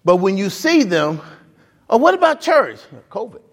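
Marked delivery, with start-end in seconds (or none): 1.9-3.4 high mock question, tossed-off answer; mock exchange. Pastor mocking stadium crowds' excuse, high voiced. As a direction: play both sides of the excuse.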